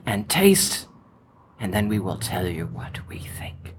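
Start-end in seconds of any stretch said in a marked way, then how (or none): 1.6-3.7 soft ASMR whisper. Acted. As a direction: murmur it in a whisper. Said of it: "A S M R".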